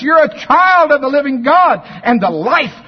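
long, high shouted hallelujahs; preaching at full force. Real, not acted. Preacher shouts praise to the congregation at full force. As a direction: cry it out to the congregation.

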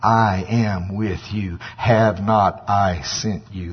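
slow, solemn pronouncement; measured divine declaration, drawn out; the Lord. Acted. God assuring Gideon he is with him, slow and solemn. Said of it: "That's the way he talks".

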